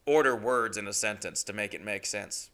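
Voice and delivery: ordinary delivery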